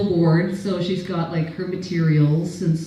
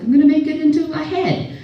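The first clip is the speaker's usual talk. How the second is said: higher, louder determined retort; mock insistent reply; 10 semitones higher, 4 dB louder than usual. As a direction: insist like a stubborn kid. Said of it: "I was like".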